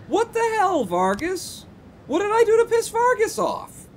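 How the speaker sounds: very high, loud exasperated yelp; baffled outcry. Real, not acted. Streamer reacts to angry boss Vargas, high baffled outcry. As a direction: cry out in real disbelief.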